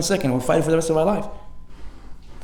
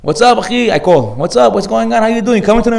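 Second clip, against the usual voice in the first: higher and louder, cheery greeting; upbeat calling; 5 semitones higher, 5 dB louder than usual. Retold or imitated. Rabbi phoning a friend about tonight, cheerful and loud. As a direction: call a buddy all fired up.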